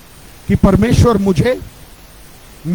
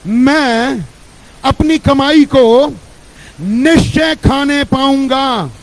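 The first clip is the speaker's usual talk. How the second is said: slow, emphatic declaration, louder and higher; preaching declaration; 4 semitones higher, 4 dB louder, slower than usual. Real, not acted. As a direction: proclaim each blessing slowly with full force. Leads declaration of scripture. (जोर से, धीरे-धीरे घोषणा करते हुए)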